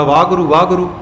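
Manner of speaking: ਵਾਹਿਗੁਰੂ shrunk to "vaa-guru"; ਵਾਹਿਗੁਰੂ run together as "vaaguru"; slurred pronunciation. Retold or imitated. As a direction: drop the "he" from ਵਾਹਿਗੁਰੂ. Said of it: "ਮੁੜ ਕੇ ਵਾਹਿਗੁਰੂ ਬਣ ਜਾਂਦਾ".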